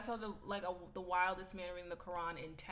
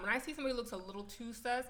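ordinary talk, nothing marked

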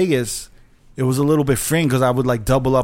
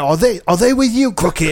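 imitated British owner, higher-pitched question; British accent; 6 semitones higher than usual. British club owner asks the DJ about the girls, imitated British accent. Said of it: "You have to do the accent".

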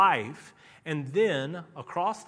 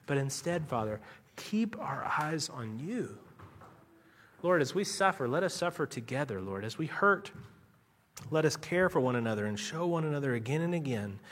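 closing prayer; 2 semitones lower than usual. Acted. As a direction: pray over the congregation.

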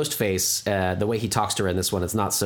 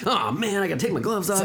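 exasperated killer; 10 semitones higher than usual. Pretend. Voicing killer fumbling with gloves.

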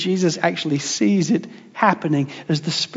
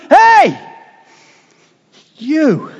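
joyful call; 7 semitones higher, 5 dB louder than usual. Acted. The Father calling out to his Son.

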